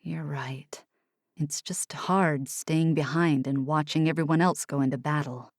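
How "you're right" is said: whispered, lower, much softer; softened. A narrator's reading. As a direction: yield gently in a whisper. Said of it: "Oliver's eyes softened".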